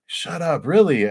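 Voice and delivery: high-pitched, delighted mock astonishment; acted happy disbelief. Potter learns about the stranger's piece, acted delighted disbelief. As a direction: squeal it a little, playing amazed.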